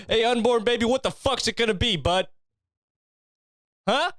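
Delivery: high, intense drill-sergeant motivation; "You got two choices" barked high and firm, no laughing; David Goggins pep talk. Pretend. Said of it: "playing fucking David Goggins audio".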